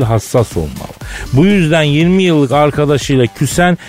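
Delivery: ordinary talk, nothing marked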